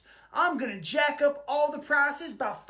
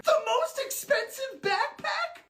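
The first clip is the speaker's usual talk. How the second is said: much higher, squeaky disbelief; squeaky shocked voice; 12 semitones higher than usual. Acted. Other character repeats the request.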